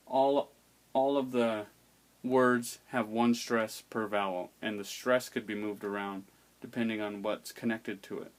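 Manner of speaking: usual speaking voice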